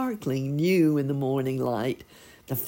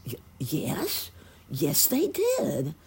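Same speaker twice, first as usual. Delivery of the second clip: hushed hesitant yes growing high and slow; stammer, then brighten into a high chirp; happier chirping; 3 semitones higher, slower than usual. A narrator's reading. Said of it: "chirped the sparrow, beginning to look much happier".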